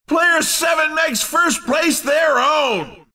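high-pitched, excited announcer; ringing victory announcement; excited winner call. Acted. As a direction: proclaim the race winner. Announcer calls the race winner.

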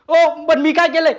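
high, loud, drawn-out plea; re-enacted cry of innocence; pained protest. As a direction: wail in protest as the blows land. Acts out protest while being beaten.

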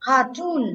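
Cartoon with the weight on TOON; stress on second syllable. Shown as a demonstration. Teacher showing where stress falls, stress on TOON. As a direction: lean on the last syllable of Cartoon. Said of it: "nó sẽ rơi vào toon".

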